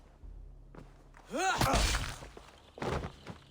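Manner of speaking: loud scornful belly laugh; mocking laugh. Gorm laughing in Eivor's face, loud mocking laugh. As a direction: laugh off the threat.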